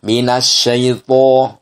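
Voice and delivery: huruf to dibaca tebal. Shown as a demonstration. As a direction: tebalkan huruf to pada minasyaito. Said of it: "Huruf to pertama". (to dibaca tebal)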